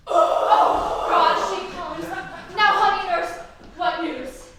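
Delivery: put-on eager girl greeting the nurse, loud and thrilled; excited. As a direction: shriek with joy as she arrives. Juliet sees nurse arrive.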